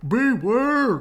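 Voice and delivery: loud, slow drawn-out warning; calling beware. A narrator's reading. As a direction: call it long and loud. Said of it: "called the bees to the bear".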